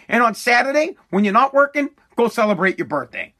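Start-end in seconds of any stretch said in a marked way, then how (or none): none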